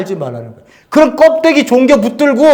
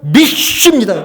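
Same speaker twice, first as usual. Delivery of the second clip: louder "믿습니다" with a laugh; loud showy declaration; 3 semitones higher, 5 dB louder, slower than usual. Shown as a demonstration. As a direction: proclaim "믿습니다" loudly like a show of faith. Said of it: "이렇게 하지". (크게 과시하듯 "믿습니다")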